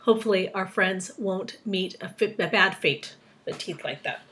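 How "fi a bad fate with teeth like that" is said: ordinary delivery, nothing marked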